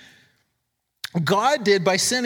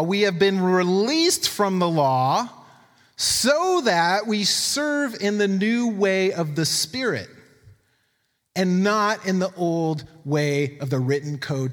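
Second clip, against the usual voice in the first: slow, raised scripture reading voice; 2 semitones higher, slower than usual. Acted. Preacher reads Romans to the congregation, slow and raised. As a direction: read it out as the word itself, slowly.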